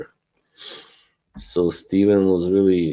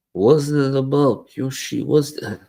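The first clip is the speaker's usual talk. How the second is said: higher, mock Russian accent asking Yoshi; a light Russian accent as Putin, pitched up; 6 semitones higher than usual. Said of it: "I'm now Putin".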